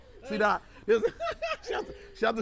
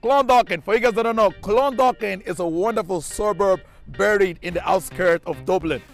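loud, slow TV presenter intro; 8 dB louder than usual. Acted. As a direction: announce it like a show host.

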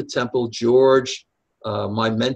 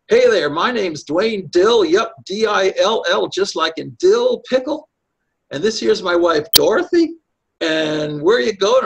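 folksy trucker; 7 semitones higher, 4 dB louder than usual. Retold or imitated. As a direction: greet the hitchhiker like a jolly backroads good old boy. Trucker introduces himself, pulling over.

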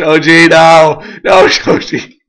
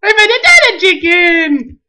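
high-pitched delighted announcement; excited squealing; 11 semitones higher than usual. Real, not acted. Excited find in game.

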